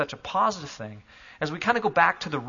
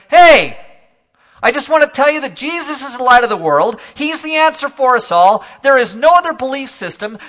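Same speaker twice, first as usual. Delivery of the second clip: loud, raised calling out to strangers; street preacher; 9 semitones higher, 12 dB louder than usual. Pretend. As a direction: proclaim to passing commuters on the platform. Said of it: "started saying to everybody".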